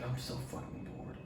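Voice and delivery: breathy whisper, no voice at all; whispered boredom. Retold or imitated. Bored in the isolation room, whispering to himself. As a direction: whisper it like you're stuck in a silent room.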